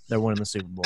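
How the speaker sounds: low, soft mocking imitation; mock confident fan. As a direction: parody his smug prediction. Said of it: "and he's like".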